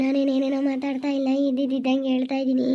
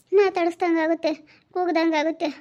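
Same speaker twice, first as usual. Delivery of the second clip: ordinary talk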